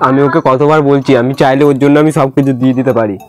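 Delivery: ordinary delivery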